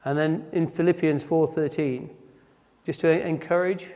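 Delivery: ordinary talk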